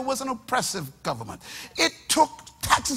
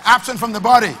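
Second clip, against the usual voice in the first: louder call; 2 semitones lower, 9 dB louder, faster than usual. Shown as a demonstration. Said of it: "I can't hear the church, I say".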